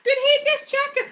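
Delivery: hammed-up gossip shock; scandalised. Real, not acted. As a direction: squeal it in disbelief. Repeated gossip question.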